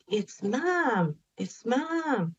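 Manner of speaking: waking husband with joyful, high-pitched news; overjoyed disbelief. Retold or imitated. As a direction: blurt it out, pitched high with wonder. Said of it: "And I'm like".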